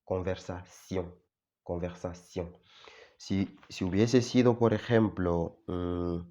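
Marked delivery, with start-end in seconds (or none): 0.0-2.6 Conversación said softer with a French sion ending; French pronunciation. Shown as a demonstration. Teacher models the French word to learners, softer. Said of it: "como pronuncio la T aquí".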